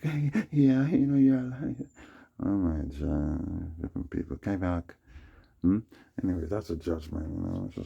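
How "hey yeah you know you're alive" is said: mock girl. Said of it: "And she's like".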